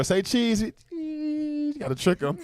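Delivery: high-pitched put-on coaxing voice; coaxing a little girl. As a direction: playfully coax a child in a high voice. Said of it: "when you tell a little girl".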